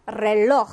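reloj with a strong h-like j, clearly sounded; Spanish reloj. Shown as a demonstration. Teacher modelling reloj for students, clear and slow. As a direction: say reloj slowly with a rasped final j for the class. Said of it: "이 번은 어떻게 읽어주실까요?".

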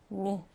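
"knee" begun on N, the K left silent. Shown as a demonstration. Teacher models the word for learners, "knee" with a silent K. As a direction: say "knee" as "nee". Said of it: "K는 그냥 묵음으로 처리하고".

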